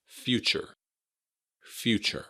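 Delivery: "Future" stressed on FEW, with a CH in the middle; careful model "Future" with the T sounded as CH. Shown as a demonstration. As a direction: say "Future" as FEW-cher, first syllable stressed. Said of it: "adds kind of a ch".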